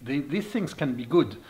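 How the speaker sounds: ordinary delivery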